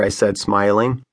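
usual speaking voice

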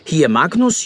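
ordinary talk

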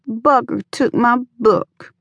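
sobbing. A narrator's reading. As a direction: sob it out like a hurt child. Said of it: "she sobbed".

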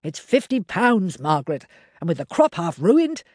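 a poor farmer pleading his reasons; lightly voiced farmer, defensive; Henry the farmer. A narrator's reading. Henry justifies himself.

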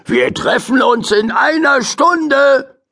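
high, slow call after her; slow call across the distance. Acted. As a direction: call it out after the flying bird. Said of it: "rief Mortimer der Elster hinterher".

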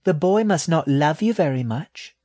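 sly, high little rat voice; high-pitched, slyly teasing. A narrator's reading. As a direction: needle slyly as a small rat. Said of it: "she said slyly".

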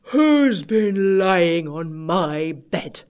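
very slow, drawn-out big bear; great big bear voice. A narrator's reading. Great big bear finds bed rumpled, in a great big voice. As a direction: boom it out slowly as a huge bear. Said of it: "in his great big voice".

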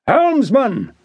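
a sudden high shout across the deck. A narrator's reading. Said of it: "Harry shouted".

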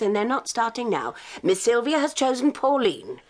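usual speaking voice

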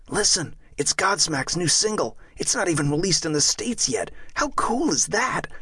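excited youth, pitch climbing at the end; eager showing off. Acted. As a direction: share it eagerly, drawing out the final question. Boy showing off new single.

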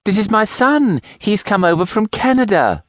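high, bubbly introduction; mother introduces her son to an old friend, excited. A narrator's reading. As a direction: gush as you present your son. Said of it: "With excitement".

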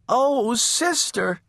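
high, slow, worried little voice; fret, drawn out and high; worried little sister. A narrator's reading. Baby calls to her chasing sister, high and worried.

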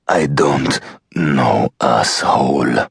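slow, lightly voiced defiant prisoner; slow, understated contempt; Buta's voice. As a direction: drag out the insult, slowly. Captive insults interrogator.

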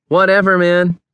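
high, dismissive retort; unsympathetic brush-off. A narrator's reading. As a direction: brush him off with no sympathy. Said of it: "was the unsympathetic response again".

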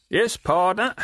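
high, sly leering call across the water; leering waterman. Acted. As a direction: Riderhood hailing Gaffer with a sly leer, pitched high. Riderhood's voice held.

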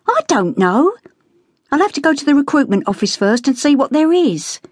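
a sister telling off the lads for laughing at war news; Becky scolding the boys. A narrator's reading.

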